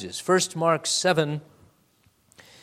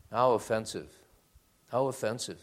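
low, soft, sniffy mock offense; mock offended millennial; 6 semitones lower, 8 dB quieter, faster than usual. Preacher mimics a millennial offended by Jesus, soft mock disdain. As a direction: imitate a scandalised modern listener, quietly. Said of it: "imagine what the millennial would say".

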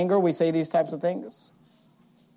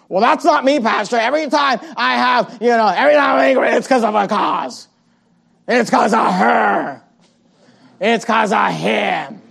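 defensive churchgoer. Retold or imitated.

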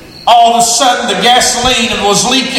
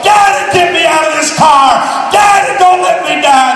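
put-on desperate screaming girl; 6 semitones higher than usual. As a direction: beg for your life at full voice. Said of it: "J. Harold said".

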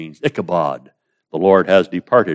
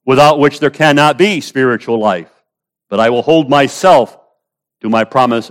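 higher and louder proclamation; preaching at full force; 6 semitones higher, 5 dB louder than usual. Real, not acted. Preacher proclaims God's promise to the congregation, full force. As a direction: declare it with power.

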